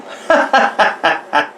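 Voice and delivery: quick, high burst of laughter; real staccato giggle; amused laugh. Real, not acted. Vlogger amused at his own googling, laughing.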